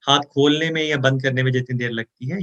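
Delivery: ordinary delivery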